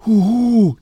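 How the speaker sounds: high, loud owl hoot; loud, drawn-out owl cry; discontented hooting. A narrator's reading. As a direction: hoot in protest from the crowd. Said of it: "tönte es unzufrieden aus der Schar".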